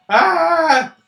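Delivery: startled yelp. Real, not acted. Player feels bugs crawling.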